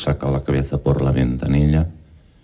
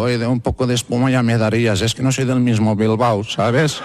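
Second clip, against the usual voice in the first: Basque customer voice; 7 semitones higher than usual. Retold or imitated. Basque client complains to barber.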